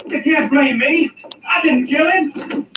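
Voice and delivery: pleading, voice rising, slow; desperate pleading. Acted. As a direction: beg them to believe you. Accused man protesting in panic.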